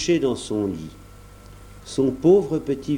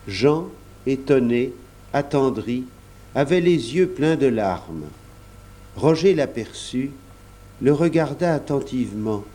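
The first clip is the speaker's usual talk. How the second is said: usual speaking voice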